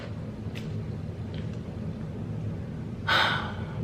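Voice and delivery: long, soft exhale; exasperated sigh. Retold or imitated. Player told he's on his own, exasperated sigh. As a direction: let out a long, drawn-out sigh.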